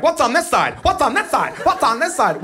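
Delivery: high-pitched mimicked little boy; excited young son. Son standing up mid-river, imitated excited kid. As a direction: repeat it high, like a kid popping up. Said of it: "My son doesn't get it".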